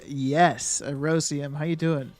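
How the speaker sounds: usual speaking voice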